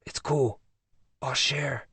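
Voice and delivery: soft unvoiced whisper; quiet whispered reply. A narrator's reading. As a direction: keep it hushed in the dark theater. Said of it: "I whispered back".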